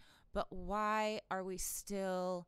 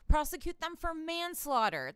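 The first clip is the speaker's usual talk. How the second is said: louder, higher, fed-up rant; exasperated outburst; 8 semitones higher, 7 dB louder than usual. Real, not acted. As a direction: throw it out in frustration. Ranting about the charges.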